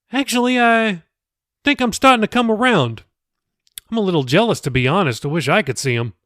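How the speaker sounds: easygoing pastor chatting; pastor Billy, friendly. Acted. The pastor recalls a girl from church, lightly voiced.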